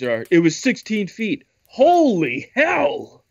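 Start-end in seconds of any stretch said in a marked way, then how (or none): 1.7-3.2 drawn-out shocked exclaim; stunned. Real, not acted. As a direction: stretch it out, stunned. Reacts to sixteen-foot alligators.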